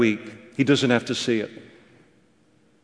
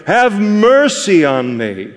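higher, drawn-out plea for mercy; mildly raised retold cry; 6 semitones higher, slower than usual. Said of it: "he cried out and he said".

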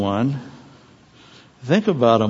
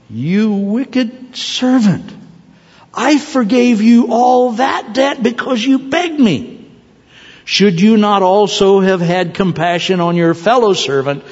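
angry master; 7 semitones higher than usual. Acted. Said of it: "His master was angry".

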